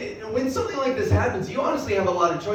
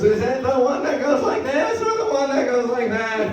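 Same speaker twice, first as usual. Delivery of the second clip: high, loud, exasperated observational-comic voice; hammed-up stand-up whine, pushed up and out; Jerry Seinfeld; 6 semitones higher, 5 dB louder than usual. Comic riffing on own gender, as a Seinfeld impression. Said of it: "I can do Seinfeld too".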